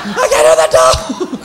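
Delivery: high shouting passerby; mock shout. Passerby at a barkless dog, high mock shout. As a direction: yell like you've gone deaf.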